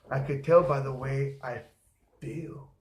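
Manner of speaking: ordinary talk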